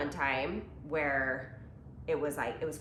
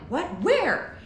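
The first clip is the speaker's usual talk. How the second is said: surprised; 10 semitones higher, 7 dB louder than usual. Retold or imitated. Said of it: "And I'm like".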